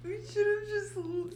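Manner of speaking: high squeaky laugh-crying. Real, not acted. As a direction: wheeze through tears of laughter. Collapsing in laughter over retakes.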